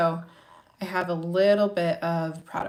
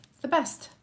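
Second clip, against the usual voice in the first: excited; 7 semitones higher than usual. Real, not acted. Gushing about her job.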